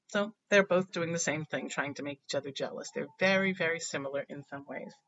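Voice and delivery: ordinary delivery, nothing marked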